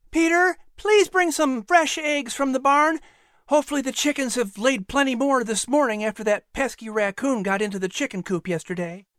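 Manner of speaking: a firm but kind mistress of the house giving orders; mother calling a servant from across the yard. Acted.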